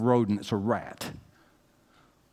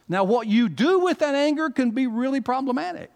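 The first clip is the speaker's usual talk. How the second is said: high, loud pulpit preaching, building; rising Southern pulpit fervor; full-force preaching; 8 semitones higher, 5 dB louder than usual. Acted. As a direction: preach it hard, lifting the congregation. Pastor preaching on anger.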